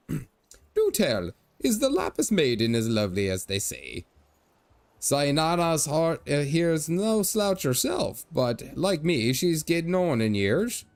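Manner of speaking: put-on jolly old Irish merchant showing off; Irish leprechaun merchant. Old merchant boasting to strangers in a hammed-up Irish brogue.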